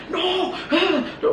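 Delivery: high, drawn-out mock protest; retold angry cry of refusal; angry outburst. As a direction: act out an angry, high-pitched "no". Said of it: "Obi started getting angry".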